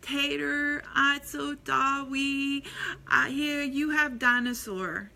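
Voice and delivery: put-on slow, childish mispronounced offer; Preston. Parent imitating a little nephew offering the toy back, babyish toddler voice. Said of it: "Preston comes over to him, and he says".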